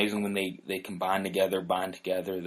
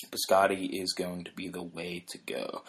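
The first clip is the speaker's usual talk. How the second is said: usual speaking voice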